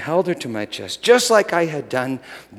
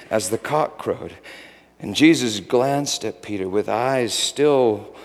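ordinary talk

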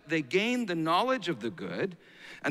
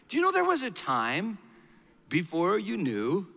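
ordinary delivery

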